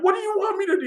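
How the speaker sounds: very high incredulous comeback; replayed protest, voice shooting up; exasperated retelling. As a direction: throw the question back in high-pitched exasperation. Acted exasperated reply to prankster.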